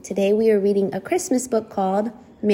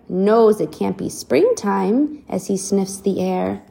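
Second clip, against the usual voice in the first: plain reading voice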